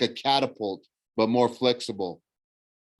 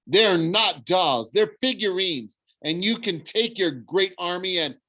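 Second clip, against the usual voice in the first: put-on high-pitched child's protest; indignant young boy; 8 semitones higher, 3 dB louder than usual. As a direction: correct him hotly, voice way up. Indignant boy argues back.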